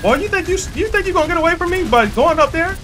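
taunting. Real, not acted. Taunting enemy player in game.

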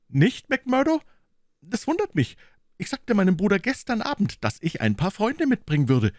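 high, quick fussy gentleman's voice; a fretful little man insisting at the gate; Thaddeus, high and hurried; nervous gentleman. Acted. Thaddeus protests.

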